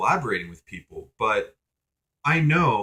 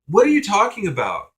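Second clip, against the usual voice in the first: high, loud, incredulous comeback; acted-out indignant question; exasperated retort; 9 semitones higher, 7 dB louder than usual. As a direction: throw it out in exasperated disbelief. Imagined exasperated retort to professor.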